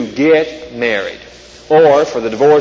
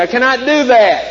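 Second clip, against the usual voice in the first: frantic refusal; 8 semitones higher than usual. A narrator's reading. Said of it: "shouted Toad".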